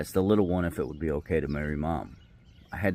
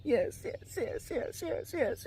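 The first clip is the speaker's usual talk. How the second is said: high squealing yes again and again, softer; imitated screeching; 5 dB quieter, much slower than usual. Dad mimicking his daughter's screeching yes on their walk. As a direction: mimic a child screeching with joy.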